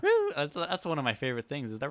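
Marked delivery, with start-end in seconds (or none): 0.0-0.3 high-pitched cheerleader "woo"; imitated cheer whoop. Cohost mimicking cheerleaders cheering him on, high whoop. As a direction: whoop like a cheering fan girl.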